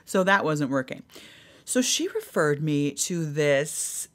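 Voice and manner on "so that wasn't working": usual speaking voice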